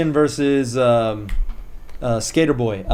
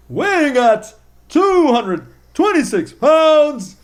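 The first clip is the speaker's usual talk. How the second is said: high, slow, booming arena introduction; ring announcer imitation; 10 semitones higher, 4 dB louder, slower than usual. Fan recalls how announcer brought Edge in, ring announcer style. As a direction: draw out each phrase, pitched way up. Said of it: "he was like".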